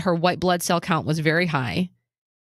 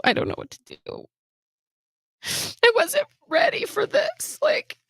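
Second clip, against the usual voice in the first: real tears breaking into the voice; halting, choked-up confession; tearful; 3 semitones higher than usual. Real, not acted. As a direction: falter as you fight back crying. Tearful recounting of mother's death.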